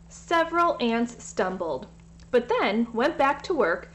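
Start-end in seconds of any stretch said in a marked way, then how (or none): none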